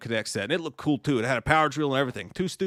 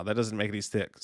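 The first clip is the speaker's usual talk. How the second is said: ordinary delivery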